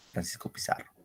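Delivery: Francisco Pizarro, susurrado; whisper. Shown as a demonstration. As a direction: dilo en un susurro, casi sin voz. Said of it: "susurro tras decirlo normal". (susurro sin voz)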